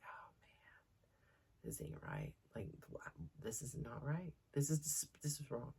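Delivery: whispered, hushed dismay; worried whisper. Retold or imitated. Mother watching her son sort the animals, whispered dismay. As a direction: whisper it in alarm to yourself.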